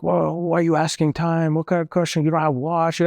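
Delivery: high, pushy mock interrogation; mocking aggressive officer. Retelling the officer grilling him, high mock demand. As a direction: imitate the officer snapping questions.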